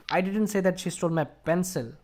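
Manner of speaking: even run, then weight on "pencil"; stress on pencil. Shown as a demonstration. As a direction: set "pencil" apart at the end. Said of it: "Just emphasize pencil".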